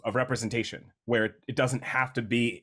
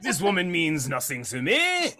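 slow, higher mock French accent; bad French pirate accent; 6 semitones higher than usual. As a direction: draw it out like a hammy French captain. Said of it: "that bad French captain pirate thing".

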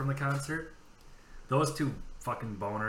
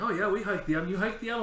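higher, a lightly voiced impressed hiker; a hint of a gushing outdoorsy type; mock impressed couple; 9 semitones higher, 4 dB louder than usual. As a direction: play the couple, eager and chummy. Voicing the hiking couple.

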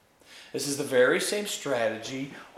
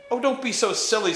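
quick, high, louder protest; exasperated scoff; 10 semitones higher, 5 dB louder, faster than usual. A narrator's reading. Scrub scolding the witch for being silly, exasperated. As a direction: brush it off impatiently at a higher pitch.

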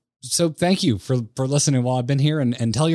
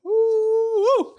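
wiping away tears. Real, not acted. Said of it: "So I wipe my eyes".

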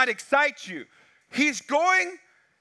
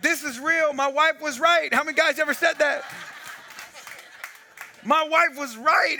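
retold terror at a shark; freaked out; 5 semitones higher than usual. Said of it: "So I freaked out".

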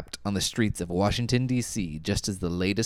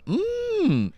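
high swooning hum; approving hum. Shown as a demonstration. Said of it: "You're supposed to go".

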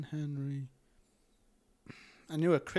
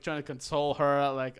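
ordinary talk, nothing marked

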